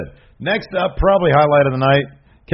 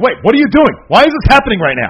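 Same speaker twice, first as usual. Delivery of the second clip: high, loud mock disbelief; retold alarmed protest; 10 semitones higher, 8 dB louder than usual. Host imitating a wrestler as the bell rings, loud bewildered protest. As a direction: protest loudly, as if caught off guard.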